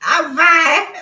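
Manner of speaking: loud, high giggly "I'm fine"; real laughter breaking through; laughing. Real, not acted. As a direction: insist you're fine while cracking up.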